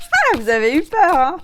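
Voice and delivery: high, loud playful tease; cheeky gotcha; teasing laugh. Real, not acted. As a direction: catch them out and giggle. Teasing after fake-out.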